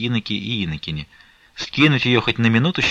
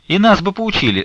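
put-on high, louder girlish chirp; eager chirping; 11 semitones higher, 5 dB louder than usual. A narrator's reading. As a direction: chirp the plea eagerly, pitched up. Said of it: "защебетали Ефлёнушка".

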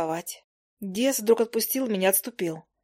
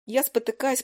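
plain reading voice

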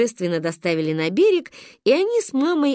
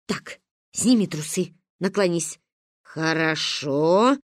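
slightly lower, businesslike voice; instructing grandmother; 4 semitones lower, slower than usual. A narrator's reading. Ba positioning the cup, businesslike instructions. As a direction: direct the child step by step.

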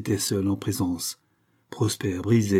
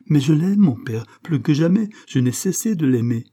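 loud, higher declaration of love; passionate protest; 6 semitones higher, 6 dB louder than usual. A narrator's reading. Son declaring his love to his father, impassioned. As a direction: insist ardently, voice raised.

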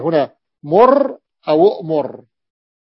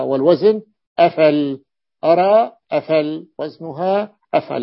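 usual speaking voice

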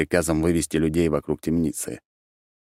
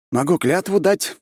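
eager, higher-pitched offer; ready offer; 13 semitones higher, 4 dB louder than usual. A narrator's reading. Mark offers to swear an oath, eagerly. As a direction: jump in with a willing promise. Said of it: "с готовностью предложил Марк".

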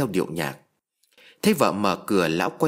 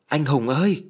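higher call up the stairs for her husband; 4 semitones higher than usual. A narrator's reading. Wife calling her husband upstairs, raised call. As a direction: call his name into the empty house. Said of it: "Mồm vẫn gọi tên chồng".

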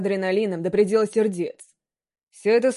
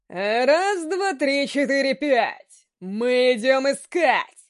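gleeful werewolf; 3 semitones higher, much slower than usual. A narrator's reading. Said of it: "Обрадованный громко заорал".

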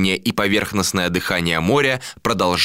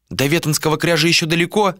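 quick, higher female question; questioning woman; 9 semitones higher, faster than usual. A narrator's reading. Woman asks captain the distance, quick higher question. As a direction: ask it fast in her higher voice.

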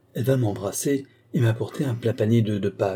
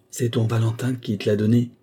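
ordinary delivery, nothing marked

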